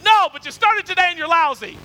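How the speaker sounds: loud, high, exasperated retort; mock-angry comeback. Balaam snapping back at the donkey, mock-angry. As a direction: fire back in comic outrage.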